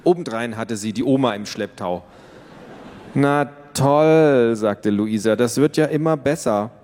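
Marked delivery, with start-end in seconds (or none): 3.1-4.6 put-on sarcastic teen. 5.4-6.7 put-on sarcastic teen.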